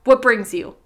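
brusque, loud question; harsh word. A narrator's reading. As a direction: demand it harshly. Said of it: "with a harsh word".

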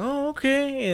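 high surprised okay; mock surprise. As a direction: go up in fake surprise. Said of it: "I'm like".

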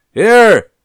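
high, very loud one-word holler; clamoring shout. A narrator's reading. Shakespeare answering from behind a fallen tree, shouting. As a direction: yell back through the din. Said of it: "voice clamors through the destruction".

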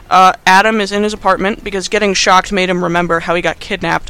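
usual speaking voice

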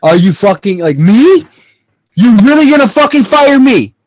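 high, loud, outraged shouting; intense anger. Acted. Fired worker at breaking point yelling at boss, intense anger. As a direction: blow up at the boss in full-voiced disbelief. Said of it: "I need, I need intense anger".

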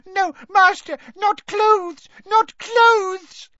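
very high, loud, panicked elf squeal; shrieking plea. A narrator's reading. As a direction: beg the master in piercing squeals. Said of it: "shrieked Winky".

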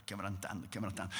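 very low, fading repetition; hushed, dropping away; melting softly. A narrator's reading. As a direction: let the word sink almost to nothing. God's heart softening, fading.

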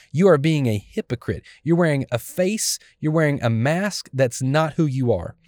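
ordinary talk, nothing marked

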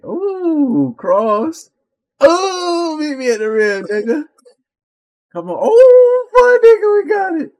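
very high, loud celebrating screams; hype screaming. Real, not acted. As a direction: scream with joy at the win. Celebrating plays in game.

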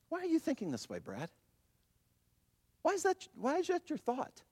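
high, softened questioning; gentle probing. Acted. Acts out Jesus questioning his thoughts.

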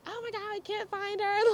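high, hushed mock panic; squeaky, soft pretend freak-out. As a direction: imitate a panicked friend on a call. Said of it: "Joy was freaking out".

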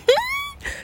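squealing little laugh; high giggle. Real, not acted. Laugh after greeting.

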